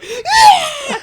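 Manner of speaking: high crying shriek; boy screaming with a scorpion on him; retold panic scream. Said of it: "I just start going".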